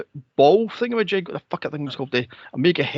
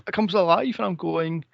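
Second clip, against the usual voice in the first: normal talking voice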